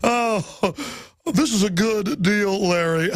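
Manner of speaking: lightly voiced smug Gore, slow and dismissive; a hint of a sneering politician brushing it off; mocking Al Gore. Host mocking Gore brushing off Perot, slow smug sneer. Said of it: "And Al Gore would go".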